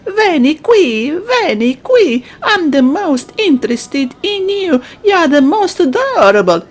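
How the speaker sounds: playful, lilting flattery; wooing suitor. Acted. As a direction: coax a sweetheart over.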